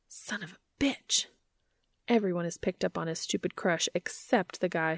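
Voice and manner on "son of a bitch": whispered under the breath, slow; whispered curse. Acted. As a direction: mutter it to yourself in a whisper. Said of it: "inner thought whispered".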